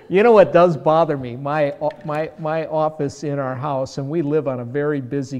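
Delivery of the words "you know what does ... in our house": ordinary talk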